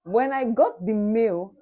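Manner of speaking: loud, slow excited build-up; loud slow excited buildup; excited exclaim. Real, not acted. Excited retelling of acceptance.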